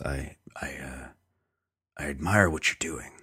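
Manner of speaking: calm menacing drug lord; Kingpin voice. Retold or imitated. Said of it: "I'm doing a pretty good impression".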